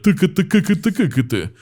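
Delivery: "Tuka, tuka" as high, hard staccato babble; hard choppy consonants. Shown as a demonstration. Mimicking the sound of a Slavic accent, "tuka" in hard high bursts. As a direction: rattle "tuka" out in hard k and t stops. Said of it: "it has a lot of".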